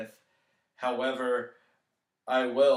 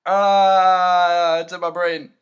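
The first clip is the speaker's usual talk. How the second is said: burning nose, loud pained outburst; nose on fire; 5 semitones higher, 14 dB louder, much slower than usual. Real, not acted. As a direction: blurt it out as the menthol burns. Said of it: "Oh, my nose is on fire".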